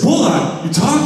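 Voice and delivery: high, astonished question; amazed disbelief. Retold or imitated. Speaking to his cat Bulldog, astonished it can talk. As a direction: gasp it out in disbelief. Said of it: "I said".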